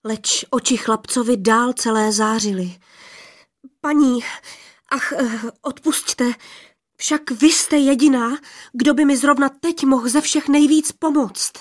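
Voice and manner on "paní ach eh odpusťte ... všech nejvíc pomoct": higher, louder, pleading in a rush; young man begging Lady Rainbow, higher and louder; urgent plea. Acted. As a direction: beg her breathlessly for help.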